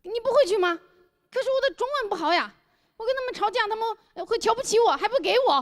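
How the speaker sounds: high-pitched, helpless put-on voice; pleading foreign friend. Foreign friend begging not to go back alone, pleading. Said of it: "托马斯：".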